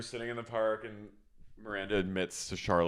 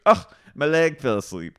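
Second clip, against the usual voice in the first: loud, higher groaning gripe; mock old complaint; 7 semitones higher, 9 dB louder than usual. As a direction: grumble like an old woman. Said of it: "and she goes".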